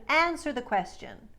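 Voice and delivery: American answer the question; American version. Shown as a demonstration. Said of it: "So instead of".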